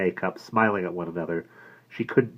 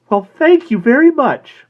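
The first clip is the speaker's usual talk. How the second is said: slow, high and loud thanks; relieved gratitude; high grateful thanks; 14 dB louder, slower than usual. A narrator's reading. Woman thanking the florist, high and slow.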